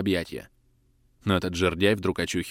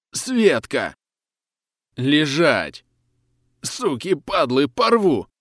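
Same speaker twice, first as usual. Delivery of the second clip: higher, louder raging yell; growling howl; 6 semitones higher, 5 dB louder, much slower than usual. Acted. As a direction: roar with a howl, out of control. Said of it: "рычит с подвывом".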